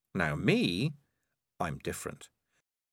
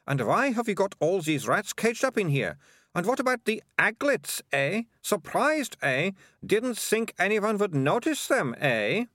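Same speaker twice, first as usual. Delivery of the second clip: put-on much higher girl's voice, pressing questions; accusing bossy girl; 11 semitones higher than usual. A narrator's reading. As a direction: interrogate them, taunting with each high question.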